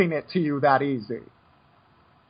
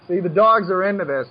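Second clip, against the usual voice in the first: ordinary delivery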